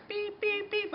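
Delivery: high soft beep sounds; imitated beeping. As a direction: mimic a device warning beep. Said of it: "It's like".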